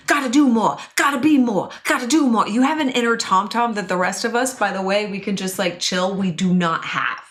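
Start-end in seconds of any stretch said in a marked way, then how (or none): 0.1-2.5 driven achiever. Retold or imitated. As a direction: bark it like a relentless inner coach. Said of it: "you're like".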